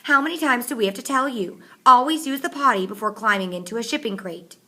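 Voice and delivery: high, scolding mock mom; mother's voice. A narrator's reading. Hayden teases M B, mock scolding mother. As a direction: nag like a mother, teasing. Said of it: "putting on his best mother's voice".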